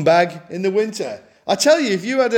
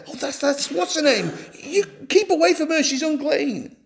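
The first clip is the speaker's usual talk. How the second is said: higher, quick, disapproving whisper-like gossip; gossiping neighbour; 6 semitones higher than usual. Retold or imitated. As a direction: crowd members warning each other about the woman, sniffy and disapproving. Gossiping crowd voiced.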